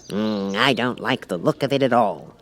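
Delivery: lightly voiced fussy king; a wary old king. The King eyes the Cat, lightly voiced king. King's voice.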